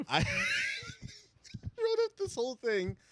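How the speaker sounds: high, laughing retelling. Real, not acted. Cracking up at his own school project story, laughing. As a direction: struggle to get it out through laughter.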